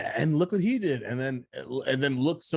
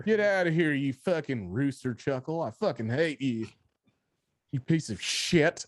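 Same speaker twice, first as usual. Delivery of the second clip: slow mock tirade in a Southern accent; hammed-up Southern-accent insults; Dr. Phil. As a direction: lose it slowly in a Southern accent. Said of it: "Doctor Phil kinda lose his shit in that accent".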